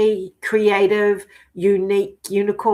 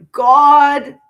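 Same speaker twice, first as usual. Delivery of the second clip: drawn-out, loud exclamation; loud, amazed "my God"; loud slow exclaim; 6 semitones higher, 12 dB louder than usual. Real, not acted. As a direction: stretch out "my God" loud in awe. Excited about energy shifts.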